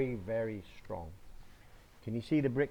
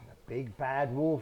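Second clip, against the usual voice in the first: ordinary delivery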